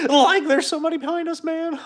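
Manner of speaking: high, panicky cartoon squeal; loud, squealing cartoon scaredy-cat; cartoon Scooby-Doo sidekick. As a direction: warn your dog pal in a hammed-up fright. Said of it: "Can you do the Scooby-Doo voice".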